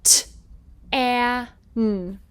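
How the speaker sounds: t, a, n said sound by sound; sounding out letters. Shown as a demonstration. Teacher sounding out tan for the class. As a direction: segment each sound apart. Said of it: "if we have tan".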